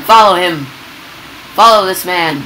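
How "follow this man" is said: ordinary talk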